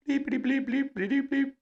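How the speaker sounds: high, fast bleep noises in a put-on voice; censor bleep voice. Censoring his employer's name with high fast bleeps. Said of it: "I'm not gonna say it right now".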